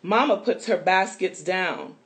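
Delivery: lively storybook read-aloud for little ones; reading to children. A narrator's reading. Reading a picture book to listening children, lively storytelling voice. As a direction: read it brightly, like story time.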